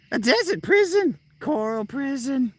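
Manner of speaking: very high-pitched cartoon voice; character impression. Streamer showing how he talks, high squeaky character voice. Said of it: "That's how he talks".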